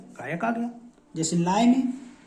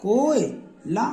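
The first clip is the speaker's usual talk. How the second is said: slow demo; much slower than usual. Shown as a demonstration. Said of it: "ला में मात्रा लगा दो".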